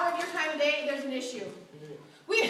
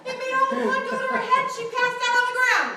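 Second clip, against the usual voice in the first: excited gossip; 8 semitones higher, 9 dB louder than usual. Retold or imitated. Gossip story gets exaggerated.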